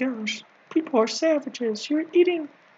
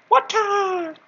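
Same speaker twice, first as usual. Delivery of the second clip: sudden high, loud yell; mock battle cry; 6 semitones higher, 5 dB louder than usual. Comedian acting out stabbing food with one chopstick, mock battle cry. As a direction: burst out with a drawn-out cry.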